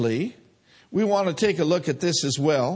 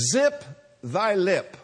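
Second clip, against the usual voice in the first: mock old King James English; Elizabethan English. Shown as a demonstration. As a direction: archaic playful phrasing. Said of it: "I thought I'd throw a little Elizabethan English in there".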